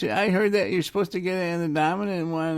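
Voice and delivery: high, puzzled voice of a fellow patient; mock high-pitched woman; high lady voice. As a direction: lift the pitch way up as a confused lady. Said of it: "She's like".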